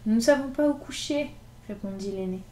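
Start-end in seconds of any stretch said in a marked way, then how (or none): 0.1-1.3 higher, lightly voiced little boy; small child. Elder boy telling Gavroche they have nowhere to sleep, small voice. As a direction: answer like a lost little boy. Lost child answers plaintively.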